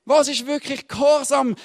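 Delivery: loud, high, fervent sermon delivery; full-force preaching. Acted. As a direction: preach it out to the whole hall.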